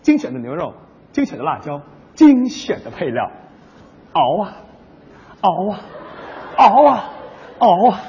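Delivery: low, soft commercial voiceover building up; mock ad voiceover. Partner slips in a soft, low sauce commercial voiceover. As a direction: savor each ingredient like an ad narrator. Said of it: "你这广告插的也太多点儿了".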